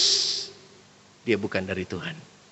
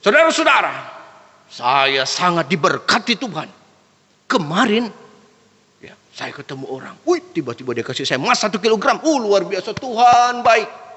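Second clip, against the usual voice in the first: showy testimony preacher. Acted. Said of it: "Daripada begini". (gaya pengkhotbah heboh dibuat-buat)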